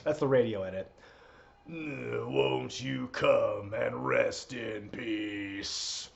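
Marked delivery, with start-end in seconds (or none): 1.7-6.1 lower, very slow, soft deadman croon; a hint of a dark funeral drawl; a lightly done Undertaker. Streamer attempts the Undertaker singing, low slow and soft. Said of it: "I can't do the Undertaker voice".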